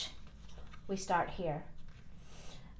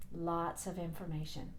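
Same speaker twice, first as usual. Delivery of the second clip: softer, lower aside; quiet; 3 semitones lower, 7 dB quieter than usual. Real, not acted. Repeated softer.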